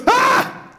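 put-on a startled kid's high cry; startled yelp. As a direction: yelp like someone just blew in your ear. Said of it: "He literally goes".

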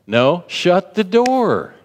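preacher's forceful no; firm command. Shown as a demonstration. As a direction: slam the door with your voice. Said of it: "it's like".

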